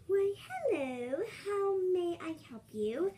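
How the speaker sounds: high, chirpy-pitched waitress greeting; put-on high waitress voice; waitress character.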